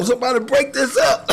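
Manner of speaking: high, loud mock plea for help; mock panicked cry. Beaten bouncer begging bystanders, high loud mock plea. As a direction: yell for someone to stop the fight. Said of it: "That boy was like".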